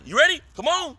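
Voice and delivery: high, pushy coaxing; urging teacher. Retold or imitated. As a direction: hurry the student along. Professor urging student.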